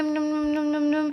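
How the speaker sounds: quick repeated "nom nom" eating sounds; munching noises. Acted. Girl gobbles up all the candy, playful munching. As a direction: gobble it up noisily in play.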